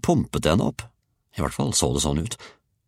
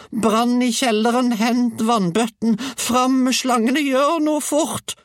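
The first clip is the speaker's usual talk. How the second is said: shrieked orders, very high and drawn out; old woman howling; 4 dB louder, slower than usual. A narrator's reading. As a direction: howl each order in a high, stretched panic voice. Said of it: "hylte den gamle kona".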